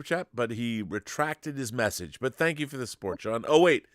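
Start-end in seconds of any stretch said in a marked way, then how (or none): none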